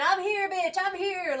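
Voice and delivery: high, loud mock herald cry; herald's proclamation. Rider on a horse ringing the bell, loudly crying out an arrival. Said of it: "somebody on a horse, ringing the bell saying".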